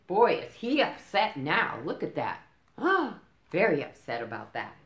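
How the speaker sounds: usual speaking voice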